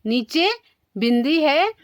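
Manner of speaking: normal talking voice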